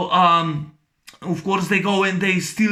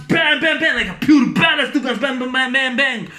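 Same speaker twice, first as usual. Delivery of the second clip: excited, higher bang bang gun noises; mock gunshots; 4 semitones higher than usual. Retelling the shootout, excited high gun noises. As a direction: fire off the bangs like a kid playing guns. Mimicking gunfire in action scene.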